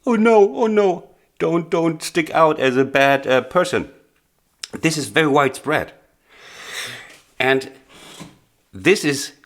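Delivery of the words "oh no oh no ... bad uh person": high, loud mock panic of a shy student; anxious student. Teacher imitating a nervous student afraid to stick out, in high mock panic. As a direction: squeal it out like a student afraid to be heard. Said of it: "So there's always a little ducking".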